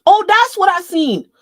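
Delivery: loud, high, drawn-out exclamation; excited discovery. Real, not acted. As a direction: cry out as you spot it, high and loud.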